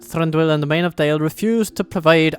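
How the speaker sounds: a dwarf envoy in a put-on Scottish accent; fake Scottish accent. Reading the dwarf envoy's report in a fake Scottish accent. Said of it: "doing a Scottish fakery".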